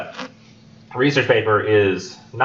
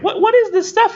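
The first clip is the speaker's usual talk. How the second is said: curious student voice; 4 dB louder than usual. Pretend. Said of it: "we're like".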